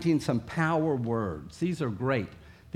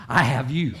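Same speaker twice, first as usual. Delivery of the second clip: sudden louder grab; got you; 3 semitones lower, 5 dB louder than usual. Shown as a demonstration. Preacher grabbing the pet at last, sudden and louder. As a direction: snap shut the trap playfully. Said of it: "I'll go like this".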